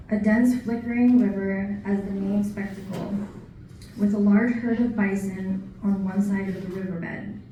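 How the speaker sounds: projected, even recital with pauses between lines; poetry reading. Acted. Poet reading a newly written nature poem to an audience, poetry reading. Reads own poem aloud.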